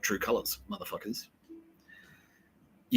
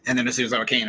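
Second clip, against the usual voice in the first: ordinary talk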